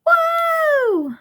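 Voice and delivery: a sudden yelp of shock, jumping way up high; high, shocked whoa as the rope pulls tight; startled whoa. Acted. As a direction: caught off guard as the trap springs. James caught in rope trap.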